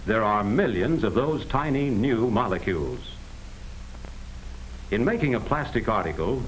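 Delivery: usual speaking voice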